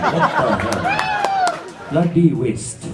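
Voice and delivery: host mock-outraged at Tendulkar retiring, laughing; mock outrage. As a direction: stretch it out in disbelief.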